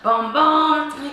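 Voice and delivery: ordinary talk, nothing marked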